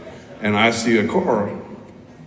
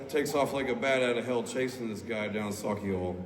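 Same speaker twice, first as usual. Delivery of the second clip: usual speaking voice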